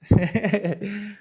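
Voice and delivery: high, loud burst of laughter; real laugh. Real, not acted. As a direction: laugh at the silly story. Laugh after the accent story.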